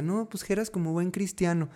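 a hint of his childhood self; little boy quoted. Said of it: "Desde chiquito yo les decía". (vocecita de niño, ligera)

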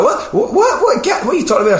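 very high, loud, stammered disbelief; hammed-up flustered squawk; mock bewilderment. Woman hearing a prank threat, imitated in high bewilderment.

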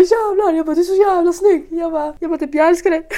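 high, loud fangirl gushing; starstruck gushing. Retold or imitated. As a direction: swoon over your idol out loud.

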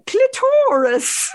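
"Clitoris" as cli-TOR-is, high and drawn out; mispronounced clitoris. Acted. Actress quoting the play's line to her guest, mispronounced clitoris. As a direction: stretch the middle of "Clitoris" into a stressed TOR. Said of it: "she'd only ever read the word".

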